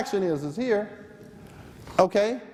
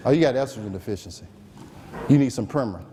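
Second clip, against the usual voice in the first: low and quick, offhand prescribing; breezy doctor; 8 semitones lower, faster than usual. Retold or imitated. Doctor prescribing to a patient with hot flashes, low offhand voice. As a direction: toss off the diagnosis like a busy doc.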